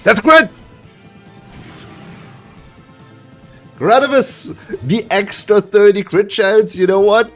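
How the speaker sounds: loud, high, thrilled yelling; excited shouting. Real, not acted. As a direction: cheer the winning crit at full voice. Big crit wins the final battle.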